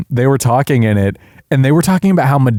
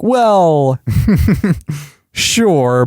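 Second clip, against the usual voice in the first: higher, drawn-out British "well"; mock-learned British accent, raised pitch; scholarly British accent; 5 semitones higher than usual. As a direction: imitate a scholarly British character. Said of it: "they used the British accent".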